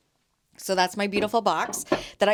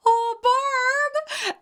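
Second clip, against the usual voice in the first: high, louder, overcome with emotion; choked up; 4 dB louder than usual. Retold or imitated. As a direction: voice catching as tears come. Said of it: "I started to get all choked up".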